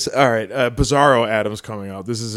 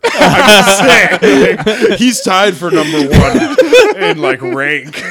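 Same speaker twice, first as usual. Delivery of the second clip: laughing; 7 semitones higher, 9 dB louder, slower than usual. Real, not acted. Laughing through a joke.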